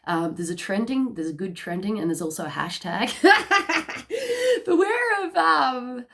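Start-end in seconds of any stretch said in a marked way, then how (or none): 3.2-4.6 high loud laugh. Real, not acted. Laughs at a trending hashtag. 4.7-6.0 laughing through words. Real, not acted. Still laughing after the hashtag.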